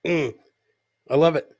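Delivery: normal talking voice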